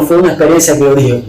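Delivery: ordinary delivery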